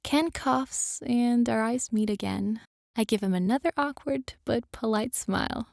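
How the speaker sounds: ordinary delivery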